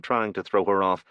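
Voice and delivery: usual speaking voice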